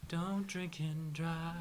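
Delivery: mock PSA. Shown as a demonstration. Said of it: "PSA slogan".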